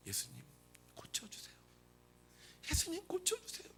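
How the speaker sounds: soft, near-whispered plea rising high; hushed pleading. Acted. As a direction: beg Jesus quietly and persistently. Woman's persistent plea to Jesus. (작고 간절하게 애원하는 소리)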